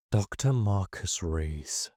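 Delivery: low, slow, steady machine voice; mechanical translator voice. A narrator's reading. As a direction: reverent robotic translation. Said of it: "its mechanical voice steady, but oddly reverent".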